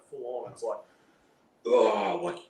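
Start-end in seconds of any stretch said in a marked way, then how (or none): 1.7-2.4 mildly raised, mocking courtroom read-out; retold screaming. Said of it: "they start screaming it".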